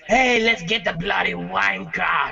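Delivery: slow, hammed-up British Penguin voice; a cartoon cockney thug growl, drawn out; mock British accent. Mocking the game's Penguin in a slow put-on British accent. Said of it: "Penguin was gonna be like".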